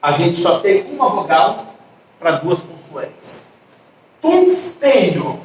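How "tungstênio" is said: TUNGSTÊNIO drawn out slowly, tungs-tê-nio; syllable by syllable. Shown as a demonstration. Teacher sounding out an odd syllable for pupils, slow tungstênio. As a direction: stretch tungstênio slowly, higher, in clear syllables. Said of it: "Não fica estranha essa sílaba?".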